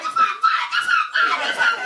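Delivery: extremely high, squeaky mock retort. Nail shop lady firing back at customer, tiny falsetto squawk.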